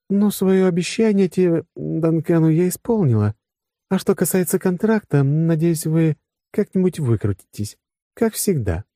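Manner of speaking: high-pitched female character voice; businesslike woman. Acted. Woman closing her promise to the men, high businesslike voice. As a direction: speak as the woman, pitched well up.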